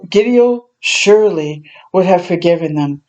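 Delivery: ordinary delivery, nothing marked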